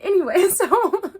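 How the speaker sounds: high, loud, slow laughing "anyway". Real, not acted. Brushing off fangirling to viewers, laughing.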